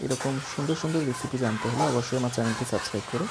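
ordinary talk

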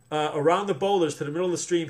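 usual speaking voice

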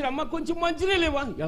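pleading prophet. Acted. Elijah asks the widow.

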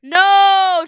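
loud, high, drawn-out protest; horrified shout. Real, not acted. Player begging the video not to go back, horrified shout. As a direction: shout it in dread.

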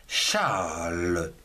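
Charles stretched long, sinking low; drawn-out word. Shown as a demonstration. Teacher presenting the prénom Charles, drawn out. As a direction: draw out the vowel in Charles, low and slow.

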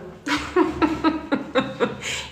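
high airy laugh at the absurd; real laughter; burst of laughter. Real, not acted. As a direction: laugh helplessly at the story. Laughing at the memory.

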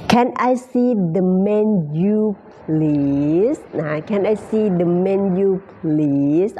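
Can I see the menu said word by word, very slowly; very slow demo. Shown as a demonstration. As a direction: drill it slowly for learners. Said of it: "ออกเสียงเป็นเมนูนะ". (พูดช้ามากๆ ทีละคำ)

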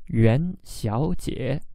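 元小姐 slowly, rising 元, dipping 小 and 姐; second-third-third tones. Shown as a demonstration. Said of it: "second tone, third tone, third tone".

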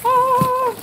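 a high, loud delighted cry; excited shriek. Real, not acted. Mom opening the coolest gift, loud high excited shriek. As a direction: squeal at the surprise.

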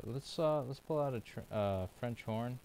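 normal talking voice

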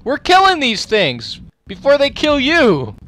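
loud high yell at the enemies; battle yell. Real, not acted. Player yelling at monsters attacking him, loud and high. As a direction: bellow it mid-fight.

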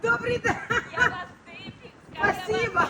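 high laugh through a greeting; real laughter carrying the thanks. Real, not acted. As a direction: laugh while saying hello. Laughs at the shouting.

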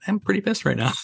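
high-pitched mock pissed-off reply; mock exasperation. Trainer answering his crew's teasing in a high mock-annoyed voice. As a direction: squeak it up high in joking annoyance. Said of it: "I go".

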